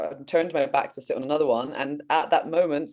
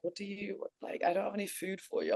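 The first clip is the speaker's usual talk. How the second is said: man's baffled reply; 5 semitones higher, 11 dB quieter than usual. Retold or imitated. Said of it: "And he's like".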